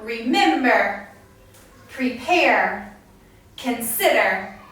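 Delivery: "remember, prepare, consider", each stressed on the second syllable. Shown as a demonstration. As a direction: separate words: re-MEM-ber, pre-PARE, con-SID-er. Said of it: "stress is put on the second syllable".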